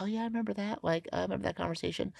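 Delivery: high, soft retold voice; imitated shop owner recalling. Voicing the shop owner's recognition.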